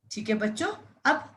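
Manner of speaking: normal talking voice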